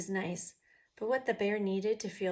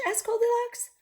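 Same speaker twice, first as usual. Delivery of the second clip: high voice; 7 dB louder than usual. Acted. Narration in Goldilocks's voice.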